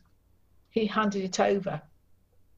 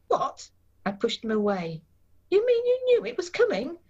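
shocked disbelief; 10 semitones higher than usual. Acted. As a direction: hit her with the betrayal she just heard. Wife learns he knew already.

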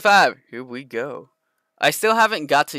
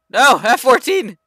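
dismayed shout; 9 semitones higher, 9 dB louder than usual. Real, not acted. Watching teammate shot down.

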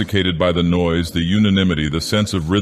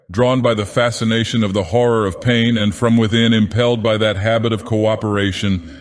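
ordinary delivery, nothing marked

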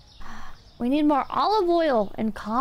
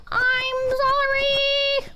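drawn out high apology; 10 semitones higher, much slower than usual. Pretend. Apologizing to game customer.

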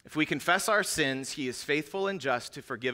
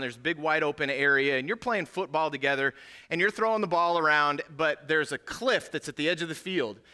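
ordinary talk

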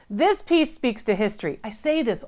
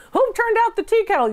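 high-pitched mock-British complaint; British accent; 11 semitones higher than usual. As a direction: put on an annoyed British host family's accent. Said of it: "in their British accent".

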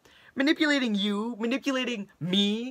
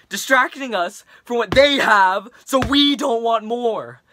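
put-on frustrated teen rant, building louder; Leon, angry rant; 4 dB louder than usual. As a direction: vent at a classmate, getting heated. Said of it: "in the following rant to his classmate".

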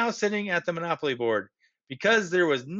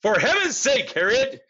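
louder, higher outburst at his wife; exasperated exclamation; 6 semitones higher, 5 dB louder than usual. A narrator's reading. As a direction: cry out in exasperation. Husband exclaims to wife in story.

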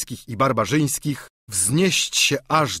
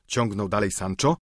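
ordinary delivery

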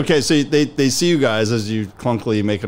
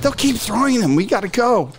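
anxious NPC; 12 semitones higher than usual. Acted. NPC urging retreat.

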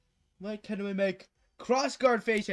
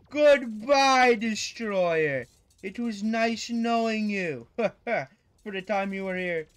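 mock dramatic farewell; 3 semitones higher, 7 dB louder, much slower than usual. Mock farewell to defeated boss.

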